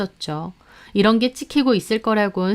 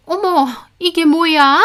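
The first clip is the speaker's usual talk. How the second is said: slow, higher shocked voice; shocked maid; 8 semitones higher, 5 dB louder, slower than usual. A narrator's reading. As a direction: stare at the screen in shock. Maid sees herself on TV.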